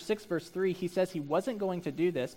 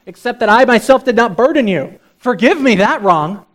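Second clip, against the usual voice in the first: loud, higher-pitched sarcastic reading; 6 semitones higher, 19 dB louder than usual. Acted. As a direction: build into a mock apology, loud and sarcastic. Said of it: "Hear the sarcasm?".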